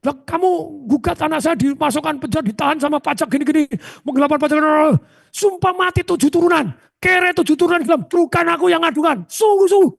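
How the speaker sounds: high mock oath sworn to the accuser; retold oath. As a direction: swear innocence in a high put-on voice. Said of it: "Sumpah langsung dia".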